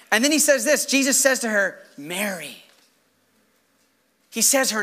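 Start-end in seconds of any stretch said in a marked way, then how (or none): 2.0-2.6 soft, low, gentle call; hushed, intimate recognition; tender naming. Acted. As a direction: say the name quietly and lovingly. Jesus tenderly says her name.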